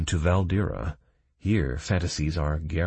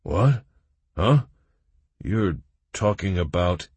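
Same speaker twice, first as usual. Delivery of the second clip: puzzled; 3 semitones higher, 4 dB louder than usual. A narrator's reading. Cray puzzled.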